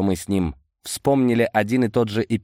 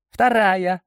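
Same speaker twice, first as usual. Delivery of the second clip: "Вторая" in Boris's much higher voice; high-voiced answer; 11 semitones higher, 3 dB louder than usual. Boris confirms the sheet to Amadei, high-voiced "Вторая".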